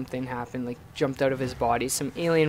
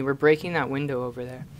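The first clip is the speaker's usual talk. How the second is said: ordinary talk, nothing marked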